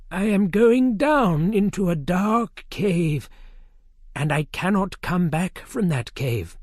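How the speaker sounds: a solemn Merlin foretelling his end; old wizard. A narrator's reading. Merlin telling Arthur of the dark cave.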